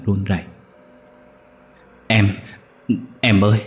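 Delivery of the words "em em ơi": crying out, louder and higher as it goes; begging the dead brother to wake up, sobbing; anguished wail building to a near scream. Acted. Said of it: "Kha khóc váng lên".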